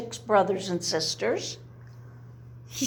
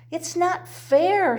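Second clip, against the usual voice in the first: slow, high complaint; upset; 7 semitones higher than usual. A narrator's reading. Baby Owl complaining to Dad about being squashed. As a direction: whine it out, hurt. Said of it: "He sounds like he's upset".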